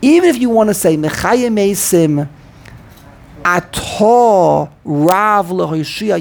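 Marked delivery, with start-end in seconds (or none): 3.4-6.2 slow, "Atah" end-stressed a-TAAH, then stressed "Rav"; weight the end of "Atah" and "Rav" equally; back-to-back accents. Shown as a demonstration. Teacher showing the forbidden reading, slow double stress. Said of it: "you can't do that".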